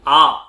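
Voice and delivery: long, loud, forceful आ; long vowel demo. Shown as a demonstration. Teacher showing the effort of a long vowel. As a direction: stretch and push आ. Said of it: "जोर भी आपको समझ में आ रहा है".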